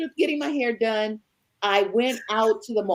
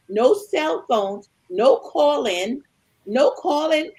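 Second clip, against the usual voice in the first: slow emphasis; 3 semitones higher, slower than usual. Real, not acted. Emphasising no way to reach him.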